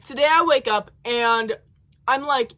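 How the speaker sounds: ordinary talk, nothing marked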